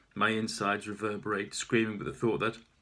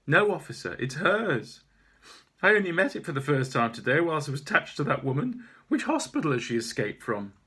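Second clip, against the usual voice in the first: indignant protest; 4 semitones higher, 7 dB louder than usual. Acted. Owner protesting to officer.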